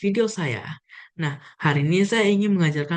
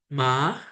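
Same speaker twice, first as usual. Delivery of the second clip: ma rising; second tone; 3 semitones lower than usual. Shown as a demonstration. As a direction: slide ma upward like a question. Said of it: "dengan nada kedua".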